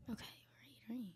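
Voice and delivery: barely audible, timid agreement; whispering. Real, not acted. Nervous podcast guest told to speak up, still whispering. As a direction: answer under your breath. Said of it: "As you whisper".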